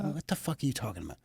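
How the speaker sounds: fast, very soft retort; hushed disbelief. Retold or imitated. Host answering partner's wardrobe remark, hushed disbelief. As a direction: toss it out quickly under your voice. Retold baffled reply to partner.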